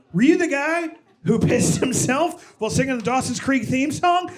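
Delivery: high-pitched, eager question; acted thrill of recognizing someone; excited recognition. Acted reunion with an old acquaintance.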